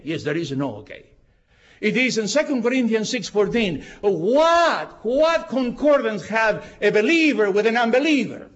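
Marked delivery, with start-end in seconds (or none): none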